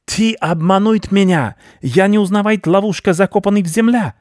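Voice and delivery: high, loud outraged rat cry; indignant rat. A narrator's reading. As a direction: protest furiously as a tricked rat baron. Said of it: "возмутился крыс".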